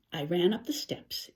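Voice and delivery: ordinary delivery, nothing marked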